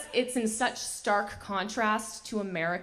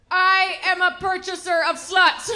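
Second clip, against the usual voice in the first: louder, higher, slow mock declaration; blurted proclamation; 7 semitones higher, 6 dB louder, slower than usual. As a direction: announce it boldly without a beat. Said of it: "he goes".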